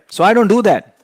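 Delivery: emphatic. Real, not acted. Coach rejects the loser habit, loud and firm. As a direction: slam the point home.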